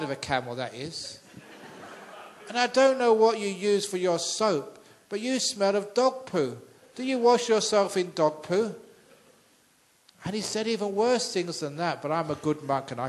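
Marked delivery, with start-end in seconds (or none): 2.5-8.7 loud, higher taunting jeer; bully jeering at the husband in the market; mocking insult. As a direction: rub it in, mocking loudly. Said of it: "started abusing him".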